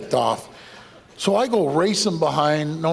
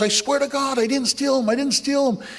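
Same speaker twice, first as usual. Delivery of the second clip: much higher, frantic pleading; pleading thief; 11 semitones higher than usual. Retold or imitated. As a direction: squeal the thief's desperate oath. Thief pleading, voiced high.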